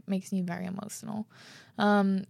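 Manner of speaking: usual speaking voice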